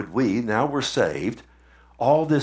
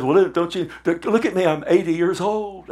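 put-on higher, complaining old man's protest; whining Moses; 6 semitones higher than usual. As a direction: grumble back at God, higher pitched. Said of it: "He started arguing with God, murmuring".

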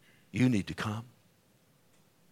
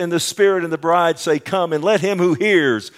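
loud, high proclamation; full-force preaching; 6 semitones higher, 8 dB louder than usual. Acted. Preacher invites the thirsty to come, proclaiming loudly.

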